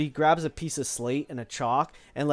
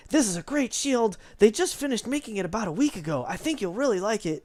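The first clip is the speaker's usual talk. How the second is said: higher, eager salesman's voice; bright rambunctious boy pushing the shield; eager apprentice; 8 semitones higher than usual. Acted. Apprentice boy selling a shield, higher, eager. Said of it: "he's like really, really, uh, uh, jovial".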